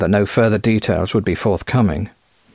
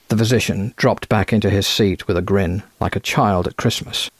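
ordinary delivery

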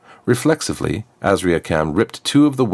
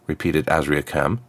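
ordinary delivery

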